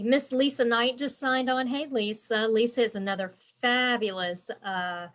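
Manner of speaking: usual speaking voice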